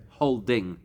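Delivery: ordinary delivery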